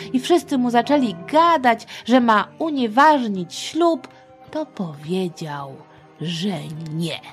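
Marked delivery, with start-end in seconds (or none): none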